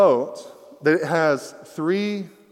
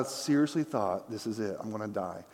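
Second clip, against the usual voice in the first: hushed dread; 4 semitones lower, 9 dB quieter than usual. Retold or imitated. Friend's near-death thought retold.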